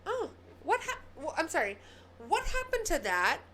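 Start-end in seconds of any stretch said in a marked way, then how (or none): none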